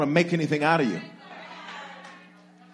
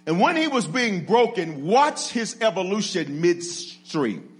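normal talking voice

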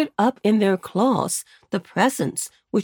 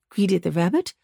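ordinary delivery

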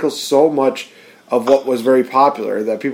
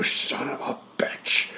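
slow, hushed, breathless curse; mock outraged whisper; 12 dB quieter, slower than usual. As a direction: hiss it in mock betrayal.

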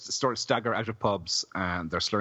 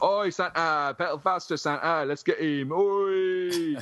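rowdy punk heckle; 9 semitones higher, slower than usual. Retold or imitated. Teasing Justin by repeating punks jeering Santa, high rowdy shout. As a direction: heckle a drunk Santa like a yob. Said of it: "you'd be like".

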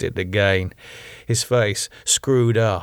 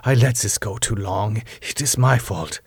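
ordinary delivery, nothing marked

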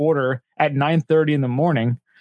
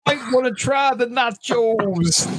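higher, slow put-on comic voice; Bill Cosby impression; 8 semitones higher, slower than usual. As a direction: drag it out like a goofy comedian. Continuing the Cosby bit.

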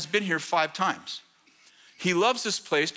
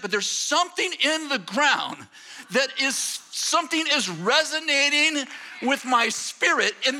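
impassioned preaching; 8 semitones higher than usual. Acted. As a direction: preach it from the heart. Preaching builds about Oxford ground.

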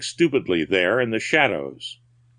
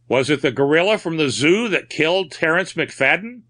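higher pitched, urgent question; a touch of pleading, rising at the end; almost pleading; 6 semitones higher than usual. A narrator's reading. Man asking the captain about the killing, almost pleadingly. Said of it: "turned almost pleadingly".

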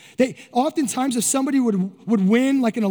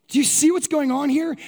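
ordinary talk, nothing marked

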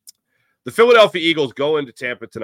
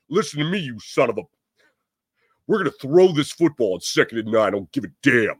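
a hint of a defiant coach; Gabe Kapler voice. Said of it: "Shades of Gabe Kapler in that voice".

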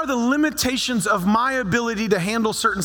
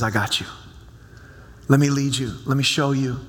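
lower, gentle reassurance as God; God's reassuring voice; 7 semitones lower than usual. Retold or imitated. Pastor voicing God to the congregation, lower and reassuring. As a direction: speak low and steady, like God comforting.

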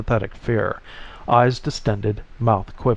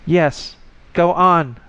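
urgent, higher-pitched command; insistent order, pitched up; 6 semitones higher, 4 dB louder, slower than usual. A narrator's reading. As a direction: urge them on firmly, pitch raised. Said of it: "the voice commanded".

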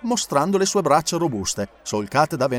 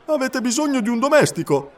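higher hopeful question; Passepartout asking; 10 semitones higher, 3 dB louder than usual. A narrator's reading. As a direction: ask eagerly for the job. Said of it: "domandò Passepartout".

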